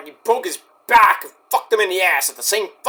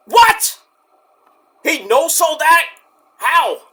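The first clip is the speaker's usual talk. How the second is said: sudden high, loud disbelieving cry; shocked what; 8 semitones higher, 3 dB louder, slower than usual. Real, not acted. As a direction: blurt it out in stunned disbelief. Shocked at a kickout.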